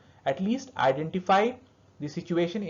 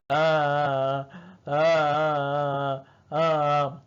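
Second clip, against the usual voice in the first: flat, steady crying on one note; slow monotone cry; 3 semitones lower, much slower than usual. Shown as a demonstration. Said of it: "something like this".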